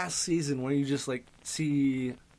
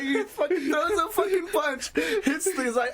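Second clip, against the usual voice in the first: laughing; 4 dB louder than usual. Real, not acted. Retelling funny fight scene.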